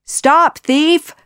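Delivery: high, loud shouted command; guards' shout. A narrator's reading. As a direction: shout after a fleeing thief. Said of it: "the guards shouted".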